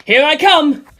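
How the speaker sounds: high, loud battle shout; a loud rallying cry as he attacks. A narrator's reading. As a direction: charge in yelling.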